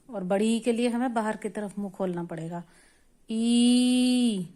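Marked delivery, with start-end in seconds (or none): 3.3-4.5 ई stretched and pushed louder; long forceful ee. Shown as a demonstration. Teacher showing the long ई with force. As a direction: push out a long ई. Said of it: "इसमें थोड़ा जोर लगाना पड़ेगा".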